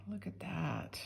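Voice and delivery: soft admiring whisper. Real, not acted. Admiring a ring up close.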